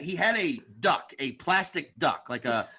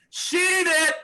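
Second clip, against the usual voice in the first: high, loud heckling shout; heckler yell; 12 semitones higher, 6 dB louder than usual. Retold or imitated. Heckler shouting at a comic in a loud high yell. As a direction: yell it down from the audience. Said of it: "some fucking asshole in the audience went".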